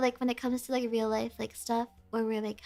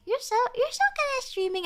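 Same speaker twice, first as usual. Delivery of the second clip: higher, sweet voice for her friend; quoting friend; 11 semitones higher than usual. Retold or imitated. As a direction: gush like her friend did. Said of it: "she's like".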